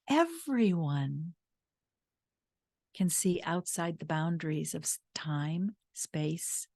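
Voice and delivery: slow, hushed whisper. Retold or imitated. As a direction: lean in and breathe it like a secret. Quiet wife gently explains.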